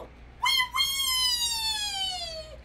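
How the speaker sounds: very high, soft squealed wail; Mickey Mouse. Pretend. Mickey toy crying, squeaky fake wail. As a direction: squeal a long pretend toy cry. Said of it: "Mickey Mouse go".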